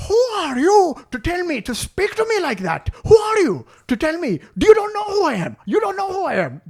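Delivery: high, loud outraged shout; mock his indignant yell; outraged customer.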